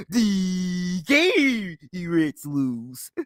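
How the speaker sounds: very drawn-out gloating call, then a chuckle; imitating his buddy's slow singsong taunt; friend impression. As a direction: drag every word out and laugh after. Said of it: "That's how he sounds when he does that".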